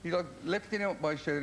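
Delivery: ordinary talk, nothing marked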